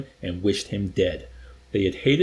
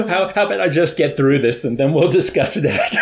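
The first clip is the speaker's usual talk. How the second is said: higher, louder, real laughing speech; talking through laughter; 4 semitones higher, 7 dB louder than usual. Real, not acted. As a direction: barely get the words out for laughing. Reader tries to continue while laughing.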